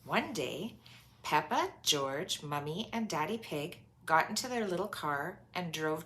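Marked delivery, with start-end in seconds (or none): none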